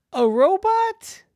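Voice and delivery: very high, loud, stretched question; astonished parent's exclamation; incredulous. Acted. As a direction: squawk it in disbelief. Parent astonished at boy's news.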